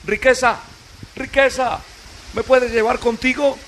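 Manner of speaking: high, pleading plea for rescue; small voice of Love begging the rich boat. Acted. Love begs Wealth for rescue.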